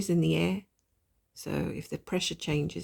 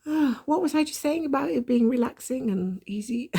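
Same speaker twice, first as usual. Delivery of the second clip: self-mocking exasperation; 8 semitones higher, 4 dB louder than usual. Real, not acted. Self-mocking after losing count.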